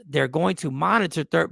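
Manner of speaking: ordinary delivery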